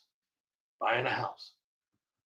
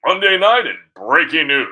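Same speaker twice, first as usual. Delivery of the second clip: higher, louder mock anchor delivery; news anchor voice; 5 semitones higher, 10 dB louder than usual. Vlogger mocks TV anchors reading breaking news, in a hammed-up higher, louder anchor voice. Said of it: "They change their voice to talk like this".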